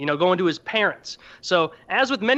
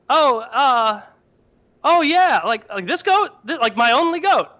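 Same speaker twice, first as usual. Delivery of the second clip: a poor man losing his only goat, hammed up; exasperated rant; 6 semitones higher than usual. Mano outraged over his only goat.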